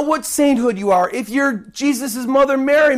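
usual speaking voice

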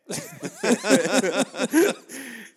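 loud, high burst of laughter; big laugh. Real, not acted. Laughing loud and high at the stranger's remark.

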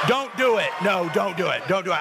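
insistent, high-pitched warning; urgent stopping call. Real, not acted. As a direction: cut in quickly to stop someone. Stopping volunteer over crowd noise.

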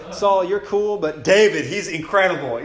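loud, high mock-fan raving; hyped-up acclaim; gushing. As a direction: imitate an excited crowd cheering its favorite. Fans voiced gushing over David.

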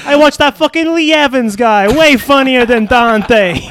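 high, loud indignant rant; mock angry rant. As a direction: rant about Dante like an offended jock. Riffing as Pitt.

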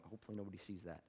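quick, quiet mutter to himself; hushed aside. Retold or imitated. Pastor recalling spotting a smudge on the dress, muttered quickly. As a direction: mutter it fast, hoping nobody notices. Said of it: "I was like".

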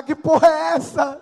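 mock outraged decorator. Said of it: "A decoradora chega lá".

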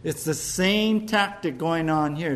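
usual speaking voice

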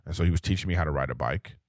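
ordinary delivery

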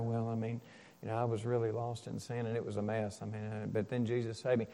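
low, soft, hurried mumble; quiet, timid testimony. Acted. Preacher mimicking a shy believer's testimony, quiet and low. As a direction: play it small and hesitant, barely audible. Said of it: "They're not quiet. They, they don't just say".

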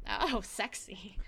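sexy voice. Pretend. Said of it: "have a sexy voice".

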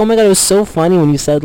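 high-pitched gushing mom. Acted. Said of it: "She'll be like".

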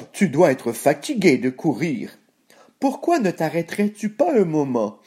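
coaxing pig voice with snorts; grunting pig. A narrator's reading. Pig coaxes the runner to stop, grunting. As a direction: wheedle like a pig, snorting after it. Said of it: "grogna le cochon".

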